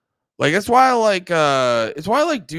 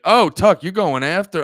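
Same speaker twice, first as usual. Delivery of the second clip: mock hype; 6 semitones higher, 7 dB louder than usual. Mock reaction to Tucker attacking.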